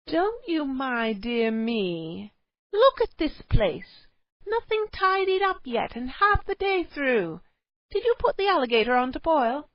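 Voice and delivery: high, loud, slow nagging tirade; nagging wife. A narrator's reading. Cave wife berates her husband about the mess, loud nagging. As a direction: scold him for the untidy cave.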